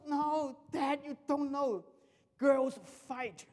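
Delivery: 高声慢慢地坚持; 像儿子一样慢慢强调纠正爸爸; 儿子的口气. Retold or imitated. Son voiced, insisting. (儿子纠正爸爸，高声慢慢说)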